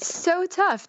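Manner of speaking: high, emphatic agreement; comic exasperation. Real, not acted. Woman agreeing how hard it was not to laugh, comically emphatic. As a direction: play up the hardship, pitched right up.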